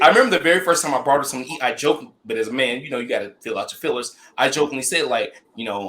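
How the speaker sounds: normal talking voice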